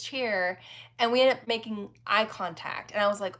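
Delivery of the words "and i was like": ordinary talk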